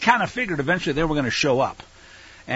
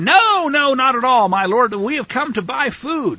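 high, loud play-acted denial; acted protest; 9 semitones higher, 6 dB louder than usual. Brothers deny spying to their lord, acted loud protest. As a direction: protest innocence in a raised, pitched-up voice.

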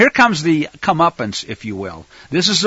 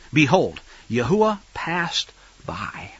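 slow breathy whisper of awe; hushed whisper; 4 dB quieter, slower than usual. Acted. Preacher reading scripture, whispering as the Lord passes by. Reading Elijah's encounter aloud.

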